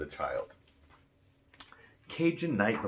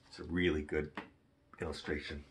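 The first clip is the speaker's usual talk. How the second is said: ordinary delivery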